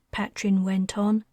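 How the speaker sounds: ordinary delivery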